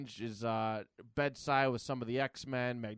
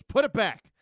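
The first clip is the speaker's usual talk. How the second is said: acted angry shout, much higher and louder; mad; 9 semitones higher, 13 dB louder than usual. Professor repeats his order to Wanda, angrily shouted. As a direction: bellow the order as a furious professor. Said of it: "he gets mad".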